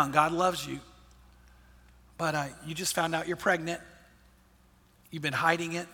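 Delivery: soft, slightly low voice with long pauses; hushed, grave retelling. Retold or imitated. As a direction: let each phrase land quietly, then wait. Hushed retelling of private revelation.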